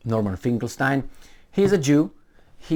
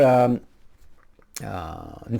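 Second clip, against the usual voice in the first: ordinary talk, nothing marked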